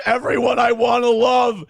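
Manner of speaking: mocking the streamer crying on stream. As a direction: ham up a self-pitying crybaby. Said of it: "He's crying because".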